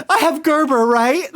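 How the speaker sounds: put-on high, loud, worried little kid; anxious kid. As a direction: beg for reassurance like a stressed child. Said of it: "I was literally so like".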